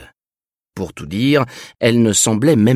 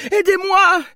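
panicked pleading. Acted. Fleeing woman begs for help.

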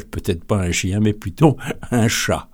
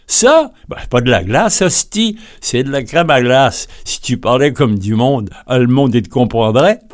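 loud, higher Quebec accent, mocking the customer; scoffing Quebec vendor; 4 semitones higher, 5 dB louder than usual. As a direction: scoff at the Frenchman in thick Quebec French.

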